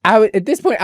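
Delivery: ordinary talk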